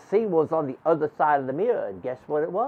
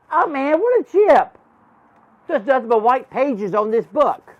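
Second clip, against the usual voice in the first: high, loud cry of discovery; surprised exclamation; 8 semitones higher, 6 dB louder than usual. Acted. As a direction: blurt it out high in amazement. Child narrator acts own surprised reaction.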